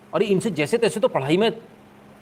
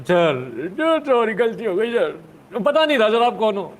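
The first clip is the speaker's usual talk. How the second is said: higher, louder, hurried begging; pleading caller; 5 semitones higher, 4 dB louder, slower than usual. Retold or imitated. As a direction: beg forgiveness like a scared caller. Retold phone apology from abuser.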